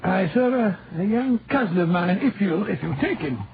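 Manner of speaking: a light put-on Scottish accent, rolling the r's. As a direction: play a canny Scot from Aberdeen, lightly. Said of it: "that delightful Scottish accent you sometimes assume".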